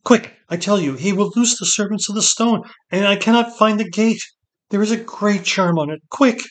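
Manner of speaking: high, loud, desperate insistence; alarmed woman urging escape; frantic urgency. A narrator's reading. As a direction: hurry him, voice climbing to a final cry. Seret urges hesitating Ged to flee.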